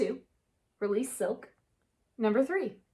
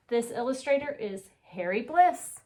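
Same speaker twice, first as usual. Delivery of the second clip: usual speaking voice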